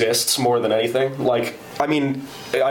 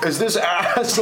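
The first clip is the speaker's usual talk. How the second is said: slow, higher imitation of an old man asking; old caller; 7 semitones higher, much slower than usual. Retelling the elderly caller asking for Ace, slow old man voice. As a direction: drag the words like a confused old man on the phone. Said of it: "so he's like".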